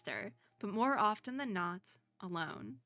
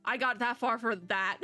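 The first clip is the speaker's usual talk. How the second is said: usual speaking voice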